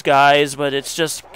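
ordinary talk

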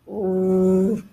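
low, drawn-out dinosaur grr; grumbling growl. A narrator's reading. Big dinosaur settling to sleep, low grumble. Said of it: "grumbled the big dinosaur".

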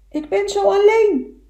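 high, loud plaintive cry; echoed call. Acted. Echo answering the beaver across the lake, loud high call.

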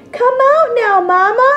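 high, loud call to Mama. Acted. T-Rex calling his mama to come outside, loudly. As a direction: call her out of the house.

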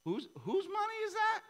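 very high, loud incredulous question; hammed-up mock God; God's challenge voice. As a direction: squeak it like a teasing challenge. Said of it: "He's like".

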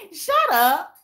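laughing it off; laughing shut up at a viewer. Real, not acted. Laughing at the comment.

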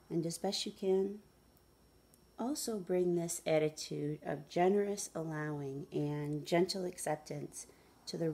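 measured meditation guide with long pauses; guided meditation. Acted. Guide asking listeners to follow the breath, guided meditation. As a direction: lead them gently through it, unhurried.

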